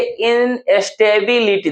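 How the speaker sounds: "इनस्टेबिलिटी" slowly in separate parts; syllable by syllable. Shown as a demonstration. Teacher presenting the next word, slow and split. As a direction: stretch out each part of "इनस्टेबिलिटी".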